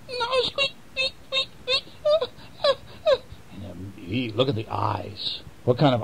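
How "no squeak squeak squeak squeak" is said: very high squeaky mouse cries; squeaking mouse. Acted. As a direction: squeak like a caught little mouse. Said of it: "And the mouse is going".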